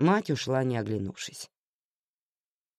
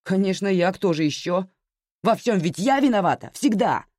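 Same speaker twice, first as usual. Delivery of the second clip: higher, louder bitter retort; irritated daughter biting back; snapping back; 6 semitones higher, 5 dB louder than usual. A narrator's reading. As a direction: snap back, stung and sarcastic. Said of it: "огрызнулась Аня".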